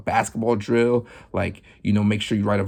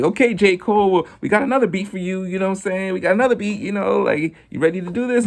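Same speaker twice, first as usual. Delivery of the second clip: high, fast hype-man voice; eager DJ; 8 semitones higher, faster than usual. Retold or imitated. DJ offering J. Cole another beat, high and eager. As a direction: pump him up for the next beat. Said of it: "And they're like".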